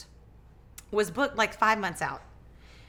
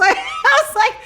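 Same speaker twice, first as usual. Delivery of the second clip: laughing; 10 dB louder than usual. Real, not acted. Laughing at the story.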